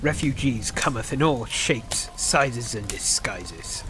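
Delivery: ominous hush. Acted. Tavern keeper warns the pirate, fading menacing murmur. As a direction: let it sink into a dark murmur.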